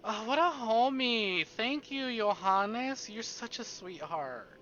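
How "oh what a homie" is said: ordinary talk, nothing marked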